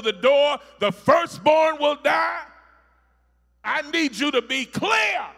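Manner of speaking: loud, climbing preacher shout; full-force sermon delivery; preaching at full force. Real, not acted. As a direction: thunder it over the congregation.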